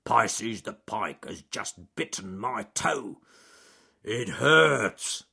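slow, sulky bear voice; self-pitying bear. Acted. Bear complains to the rabbit about his toe, slow. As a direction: moan it slowly about the toe. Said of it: "said Charles Growly Bear".

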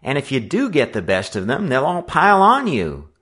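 high-pitched cautioning voice; worried, urgent counsel; interfering warning. A narrator's reading. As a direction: warn a friend of the danger. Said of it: "Fred interfered".

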